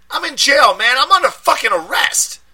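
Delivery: high, loud street voice in a panic; panicked friend. A narrator's reading. Deshaun panicking down the phone at his friend, loud and high. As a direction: yell it like you're scared and angry.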